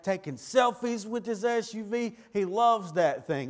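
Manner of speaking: building sermon energy; preaching. Acted. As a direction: paint the bragging SUV man with rising fervor.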